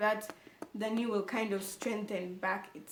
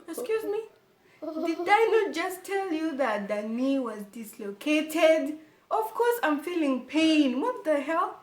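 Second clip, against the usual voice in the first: higher, louder and slow, exasperated; indignant outburst; 6 semitones higher, 5 dB louder than usual. Real, not acted. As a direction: let the outrage spill out. Outraged at doctor's question.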